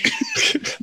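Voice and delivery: laughing squeak. Real, not acted.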